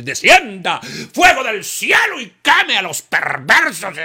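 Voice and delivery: loud, slow fire-and-brimstone curse; mock curse. Believer curses the wicked, loud slow curse. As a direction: call down fire in fury.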